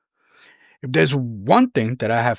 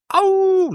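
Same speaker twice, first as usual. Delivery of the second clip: very high, sustained "ow-oo" howl; wolf howl; 3 dB louder than usual. Pretend. Speaker rallies the city to make it happen, howling. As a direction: let out a playful wolf howl.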